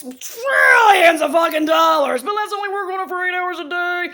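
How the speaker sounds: loud, high outraged yell; furious shout. Real, not acted. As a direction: blow up at the absurdity. Angry rant about government.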